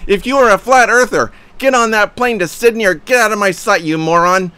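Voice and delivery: high, loud furious rant; raging read of his own comment; frothing at the mouth. Retold or imitated. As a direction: spit the insults out in a rage. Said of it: "Sorry about that rant".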